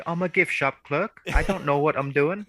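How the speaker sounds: high-pitched British shop clerk; British accent. Acted. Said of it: "speaking in a British accent".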